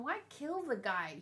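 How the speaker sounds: normal talking voice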